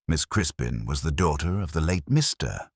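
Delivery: ordinary delivery, nothing marked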